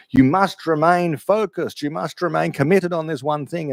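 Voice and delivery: motivational trainer. Retold or imitated. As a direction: lightly mimic an inspirational gym poster. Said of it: "the way in which the trainers talk".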